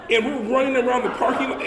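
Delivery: normal talking voice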